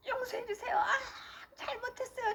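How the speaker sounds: high wailing plea for mercy; tearful begging. Retold or imitated. Bent over crying before God, re-enacted high wailing plea. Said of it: "하고 우는데".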